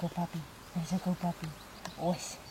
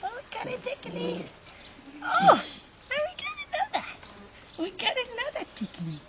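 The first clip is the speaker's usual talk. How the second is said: high excited coo; 11 semitones higher than usual. Real, not acted. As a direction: pitch it up in delight. Excited cooing.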